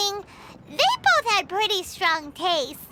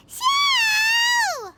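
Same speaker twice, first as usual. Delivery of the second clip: even higher, louder call into the empty air; shouting his name again; 12 semitones higher, 3 dB louder than usual. Real, not acted. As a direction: roep nog harder, hoog en luid. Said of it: "let me try again".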